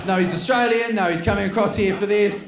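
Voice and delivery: high, loud mock insistence; insistent hostess. Hostess vouching for the comedian to guests, high insistent voice. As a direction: protest over the room's indifference.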